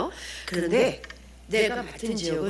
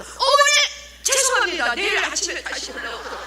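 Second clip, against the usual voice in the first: panicked apology; 11 semitones higher than usual. Acted. Evangelist dodging the grandmother's attack, high hurried-retreat apology. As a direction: cry out the first word, then back off apologising.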